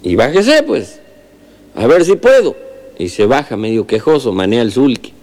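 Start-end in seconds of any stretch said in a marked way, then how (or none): 0.0-2.5 high-pitched rural healer greeting a neighbour; old healer voice. Acted. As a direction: pitch the healer much higher, friendly country greeting. Healer answers.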